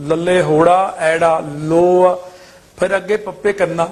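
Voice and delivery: normal talking voice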